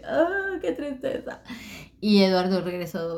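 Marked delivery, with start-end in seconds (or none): 0.0-1.4 high whimpering mock cry; mock sobbing. Sobbing after being called a coward, high whimper. Crying in the retelling.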